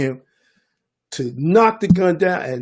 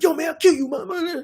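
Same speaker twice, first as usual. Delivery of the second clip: much higher mock tough-kid voice; 12 semitones higher than usual. Youths threatening before recognizing him, high mock tough talk. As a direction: play a kid acting hard, pitched up. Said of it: "They were like".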